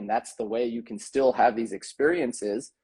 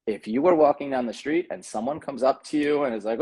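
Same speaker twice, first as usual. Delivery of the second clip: usual speaking voice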